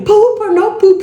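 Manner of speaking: Poop or no poop shouted high and slow; frustrated loud call. Retold or imitated. Frustrated wife calling to husband across the store. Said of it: "Já tava frustrada".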